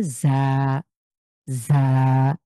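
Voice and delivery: জা with a buzzing z from the seen spot, not ঝ; za not jha. Shown as a demonstration. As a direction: buzz জা where you said seen. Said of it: "ঠিক বাংলার ঝড় মতো না".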